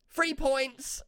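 high-pitched mock shout, little extra volume; retold shout. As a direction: imitate a cyclist calling out after the car. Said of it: "he shouted at me".

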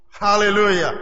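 ordinary talk

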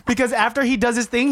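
normal talking voice

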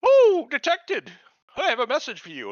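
high, put-on voice, loud at first; mimicked character voice. Man talking to himself in the story, high mock voice. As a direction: pitch it way up, stretching the opening. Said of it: "he's like, talking to himself".

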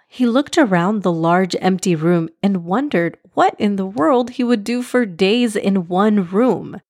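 ordinary delivery

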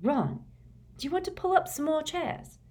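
put-on high girlish voice; light brisk schoolgirl; Hermione voice. A narrator's reading. Hermione's voice in audiobook.

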